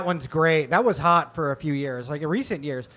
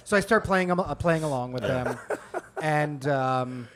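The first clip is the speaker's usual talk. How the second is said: usual speaking voice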